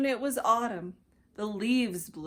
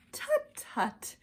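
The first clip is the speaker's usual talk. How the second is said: much higher, dismissive tutting; high tut tut. Acted. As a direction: brush him off, high and prim. Maisie dismissive.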